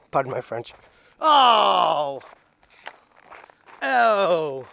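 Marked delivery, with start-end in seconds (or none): 1.2-4.7 long, loud falling "oh"; disgusted groan. Real, not acted. Owner seeing what the gross dog did, loud disgusted cry. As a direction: cry out in disgust, high and sliding down.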